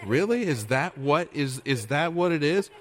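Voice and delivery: high, incredulous "Really?" with a mocking lilt; sarcastic disbelief. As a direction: scoff at the idea of short church. Preacher mocks short-church attitude.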